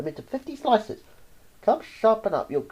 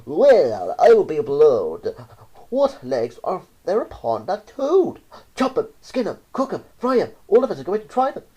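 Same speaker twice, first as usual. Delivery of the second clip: loud excited mob cry, higher at the end; screaming in French; 3 semitones higher, 7 dB louder than usual. A narrator's reading. French townsfolk yell at the toad, loud. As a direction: bellow it like a hungry crowd. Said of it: "They screamed in French".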